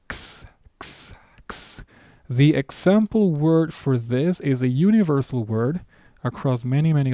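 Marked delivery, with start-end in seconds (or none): 0.1-1.8 X whispered as a bare ks hiss; whispered ks sound. Shown as a demonstration. Teacher showing the letter X sound: whispered ks. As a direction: breathe out the ks sound with no voice. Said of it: "It's a K S sound".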